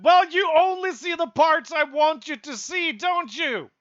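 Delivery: taunting retort. Acted. Imagined voice taunting the vlogger, sneering retort. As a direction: throw it back smugly.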